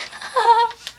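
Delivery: high self-amused giggle. Real, not acted. Laughing at herself.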